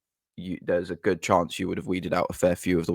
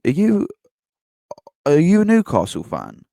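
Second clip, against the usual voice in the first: mock challenging question; 10 semitones higher, 6 dB louder than usual. Confronting the fan.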